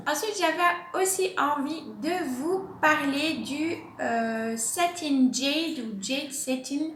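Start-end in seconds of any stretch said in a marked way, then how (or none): 4.6-6.9 French speaker trying an English accent on the names; slow, careful English-accented plant names; self-mocking attempt at an English accent. Real, not acted. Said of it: "mon accent magnifique anglais".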